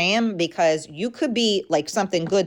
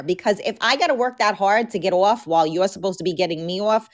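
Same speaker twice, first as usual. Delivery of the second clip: ordinary delivery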